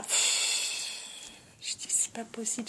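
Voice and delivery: unvoiced whisper, disbelieving; whispered aside. Real, not acted. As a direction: breathe it out, scandalized under your breath. Whispered aside about the couple. (chuchoté, consterné)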